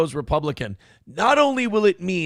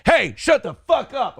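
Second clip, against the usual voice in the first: mild mock yell; 7 semitones higher than usual. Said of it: "some lady goes".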